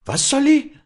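very high, loud disbelieving question; incredulous. A narrator's reading. Walli cannot believe Josef's order, incredulous high outburst. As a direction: squeak it as if you misheard. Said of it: "die nicht recht zu hören glaubte".

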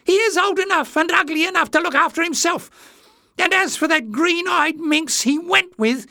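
very high squealing rant; loud, squeaky indignation; high-pitched screech. A narrator's reading. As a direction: screech spitefully, far up in pitch. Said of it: "his voice broke off in a high-pitched squeal".

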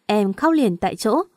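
ordinary delivery

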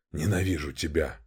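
soft, bitter, low; quiet hatred. Acted. Bitter outburst at Lera. (тихая ненависть)

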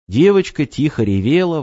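usual speaking voice

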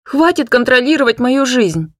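put-on high, loud teenage yell; shouting. Hanna screaming at her father over the party, loud and high. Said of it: "кричала я отцу".

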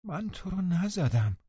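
high, slow, plaintive denial; moaning protest. A narrator's reading. Catherine denying she hit her cousin, moaning. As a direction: draw it out in a plaintive moan. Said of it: "کاتی نالید".

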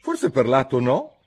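put-on cautious little girl; careful, a bit unsure Alice. Alice voiced.

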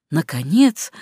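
usual speaking voice

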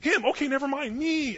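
high, loud plea to be noticed; eager attention-seeking. Retold or imitated. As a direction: wave someone down with your voice. Acted plea for the president's attention.

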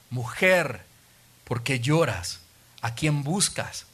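a lightly voiced tender Jesus; gentle Jesus voice. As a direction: ask her gently, like a kind teacher. Jesus voiced in the retold story.

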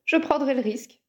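boastful. A narrator's reading. Owen shrugs off Harry's warning, mildly boastful. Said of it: "fanfaronna Owen".

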